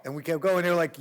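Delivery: ordinary delivery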